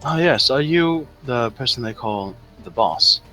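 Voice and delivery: usual speaking voice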